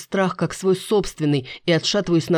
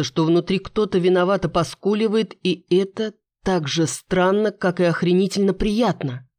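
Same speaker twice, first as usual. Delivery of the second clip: plain reading voice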